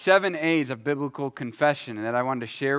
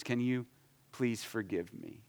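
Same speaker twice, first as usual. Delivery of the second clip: sincere ask; 2 semitones lower, 10 dB quieter than usual. Shown as a demonstration. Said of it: "Actually say those words".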